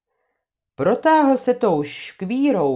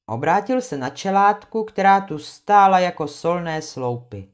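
plain reading voice

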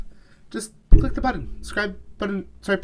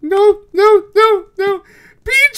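higher and louder, panicked protest; 8 semitones higher, 6 dB louder than usual. Real, not acted. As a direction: cry out as the monster nears. PJ is closing in.

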